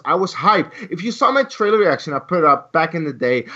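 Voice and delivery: ordinary delivery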